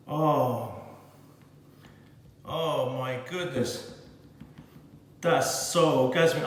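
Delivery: low, slow, hushed sigh of pleasure; a low, hushed moan of delight; hushed ecstasy. Real, not acted. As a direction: melt into the first bite. Tasting the warm cake.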